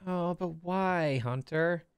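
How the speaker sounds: put-on slow, high-pitched childish protest; high, drawn-out kid's plea; little kid. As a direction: drag it out like a child pleading with big brother. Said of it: "the little kid's just like".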